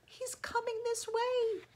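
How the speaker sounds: put-on very high, thin child's squeak; small frightened voice. A narrator's reading. Eddie in the basket hears the bear coming, frightened. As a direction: report the danger in a tiny voice. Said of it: "whispered Eddie".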